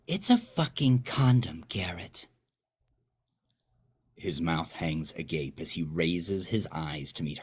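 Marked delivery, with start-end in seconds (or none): 0.1-2.3 loud, high snap that drops to a whisper on Garrett; furious accusation. Acted. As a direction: spit the words at him, then hiss his name. Wife confronts cheating husband.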